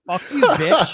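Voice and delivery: loud laughing fake curse at a rival; mock Valtteri insult. Voicing Valtteri's rude finger at George, loud and laughing. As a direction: bark it out half laughing.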